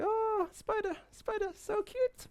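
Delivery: put-on sing-song baby voice, charmed; cooing baby. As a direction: coo at the spider like a delighted toddler. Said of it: "is like".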